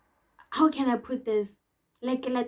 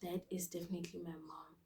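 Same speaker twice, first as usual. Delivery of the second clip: unvoiced whispered dread; 4 semitones lower, 16 dB quieter than usual. Realising his mom is outside, whispered dread. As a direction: whisper it like you're doomed.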